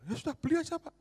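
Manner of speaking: just buy it, eagerly; brisk generous decision. Retold or imitated. Businessman decides, retold.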